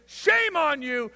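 high, loud, slow scold; shaming father. Retold or imitated. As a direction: drag out shame in scorn. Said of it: "where was his dad".

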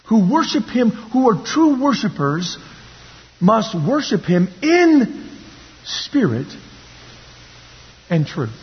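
ordinary delivery

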